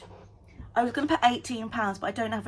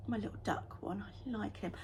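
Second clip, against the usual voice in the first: whispering; 9 dB quieter than usual. Real, not acted. Quiet aside about duck.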